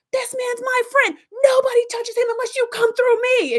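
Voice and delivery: high, loud, slowed battle cry; fierce protective yell. Retold or imitated. Harry shouting down the gang attacking his friend, fierce yell. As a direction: roar it like a man guarding his friend. Harry defends Tom swinging pipe.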